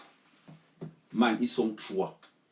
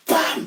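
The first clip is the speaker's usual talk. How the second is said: sudden shouted bam sound; loud bam; 9 dB louder than usual. Retold or imitated. Telling a friend how to strike first, a loud shouted bam. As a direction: smack the word out like a blow. Acting out hitting with bottle.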